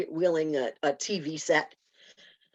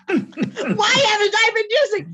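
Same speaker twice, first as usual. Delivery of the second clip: much higher and louder, half-laughing, half-shouting; laughing disbelief; 7 dB louder, slower than usual. Real, not acted. Telling the host why she started swearing, laughing loud. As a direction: crack up at what you missed all those years. Laughing at her own revelation.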